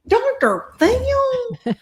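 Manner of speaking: high, drawn-out gasp of disbelief; stunned disbelief. Retold or imitated. Told Doctor Phil watches her, high stretched-out disbelief. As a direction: stretch it out, too amazed to believe it. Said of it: "And I was like".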